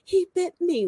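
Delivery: put-on high, whiny little-boy voice; little son complaining. Son tells dad the dog bit him, high whiny pout. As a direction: pout it like a small child tattling. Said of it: "And he's like".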